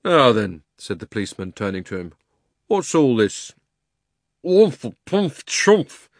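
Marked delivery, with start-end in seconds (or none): 0.0-0.6 loud call for order; lightly voiced policeman. 2.7-3.5 brisk official question; lightly voiced policeman. 4.4-6.1 high, slow, smothered mumble; muffled under a hat. Acted. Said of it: "voice from inside the hat".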